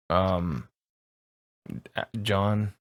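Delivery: ordinary talk, nothing marked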